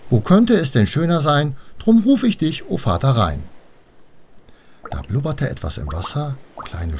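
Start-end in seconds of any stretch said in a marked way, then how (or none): 0.1-3.4 much higher, more insistent call; repeated call. A narrator's reading. Annabel tries calling Vater Rhein once more, high and insistent. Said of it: "Also sagte sie es noch einmal".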